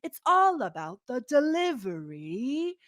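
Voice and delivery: affected drawn-out delivery; Moira. Shown as a demonstration. Podcaster showing how Moira talks on the show, affected and drawn out. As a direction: stretch and swoop the words theatrically. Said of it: "in full Moira voice".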